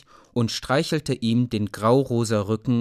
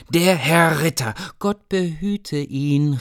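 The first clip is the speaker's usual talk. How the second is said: high, slow pious mother's voice; higher voiced peasant mother, slow and reverent; mother's reverent blessing; 7 semitones higher, 4 dB louder, slower than usual. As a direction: bless the knight with a curtsy in the voice. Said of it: "rief seine Mutter".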